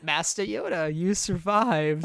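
slow, drawn-out villain voice; slow hammed-up Sith lord; mock Palpatine. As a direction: savor each word like a sinister emperor. Palpatine voiced in a joke scene.

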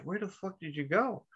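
quiet, high-pitched disbelief; baffled question. Retold or imitated. Son asks his parents about their motorcycle mugs, baffled.

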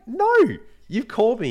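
high, loud retold protest; indignant refusal. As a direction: push back at the caller, exasperated. Retold pushback to suspicious caller.

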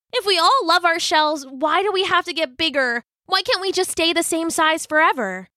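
high, complaining storybook voice; frustrated cry. A narrator's reading. Young crab protesting about new shells, very frustrated. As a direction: raise the pitch in exasperated protest. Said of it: "in a very frustrated voice".

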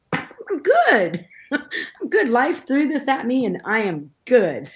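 high-pitched, laughing 'I'm fine'; mock self-assurance. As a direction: poke fun at your own past confidence. Voices her past self-confidence.

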